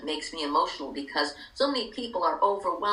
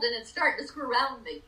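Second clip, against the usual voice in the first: normal talking voice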